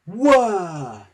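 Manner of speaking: loud high whirr; whirring wheels. A narrator's reading. Narrator making Thomas's wheels whirr. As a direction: imitate the wheels spinning.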